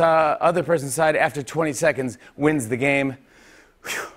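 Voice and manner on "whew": out of breath. Real, not acted. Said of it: "I'm already out of breath".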